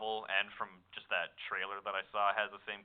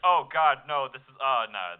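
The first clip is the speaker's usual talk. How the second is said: louder hammed-up protest; mock alarmed girl; 4 semitones higher, 13 dB louder than usual. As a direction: cry out in fake alarm. Voicing girl character's reaction.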